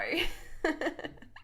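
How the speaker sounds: high, airy chuckle; real voiceless giggle. Real, not acted. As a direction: laugh at your own lack of preparation. Laughs at own method.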